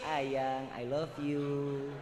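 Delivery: husband whispering love before sleep, tender; tender sweet talk. Shown as a demonstration. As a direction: coo it to your wife at bedtime.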